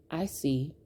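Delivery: low, soft, unbothered reply; calm. Retold or imitated. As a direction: answer quietly with cool composure. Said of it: "I just look at her calmly. I said".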